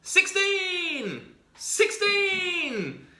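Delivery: high, loud chanted yell; repeated high excited shouts of a boy jumping; kid yelling. Acted. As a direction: yell it out gleefully like a bouncing kid. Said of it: "he's yelling".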